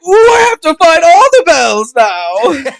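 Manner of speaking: high, loud, gleeful mock squeal; hammed-up eager shout; Epstein. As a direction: whoop it with over-the-top excitement. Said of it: "Epstein would have been like".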